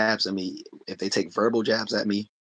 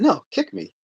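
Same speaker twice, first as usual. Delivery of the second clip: imitating Bruce Lee; 5 dB louder than usual. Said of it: "He said".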